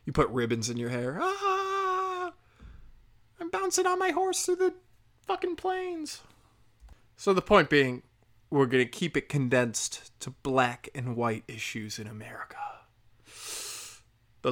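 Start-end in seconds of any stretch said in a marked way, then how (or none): 1.2-6.2 put-on much higher, slow, prancing girly voice; hammed-up girly delight; mock little girl. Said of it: "like a little girl". 12.1-12.8 whisper. Real, not acted. Sarcastic aside ending sentence.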